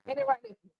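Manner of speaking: high, loud teasing question; teasing call. Real, not acted. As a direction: tease him playfully.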